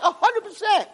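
high, emphatic confirmation; fervent assurance. Retold or imitated. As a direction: insist with total conviction, acting it out. Final assurance to the friend.

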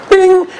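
high playful bing; app chime. Retold or imitated. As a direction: chirp it like a phone chime. Imitating the app's sound.

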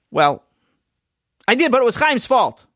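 put-on a boy blaming his brother; young Sammy shifting the blame; sheepish child. Child Sammy voiced high.

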